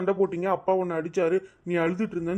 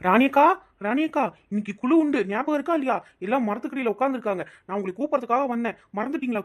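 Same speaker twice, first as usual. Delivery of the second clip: calling out; 4 semitones higher than usual. Acted. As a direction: hail her from a distance. Calling neighbour from outside.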